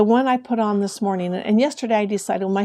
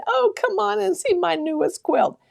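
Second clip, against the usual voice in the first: put-on much higher, sweet old grandma invitation; grandma's voice; 11 semitones higher than usual. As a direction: call the kids in like a proud grandma showing her quilt. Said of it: "and she'd say".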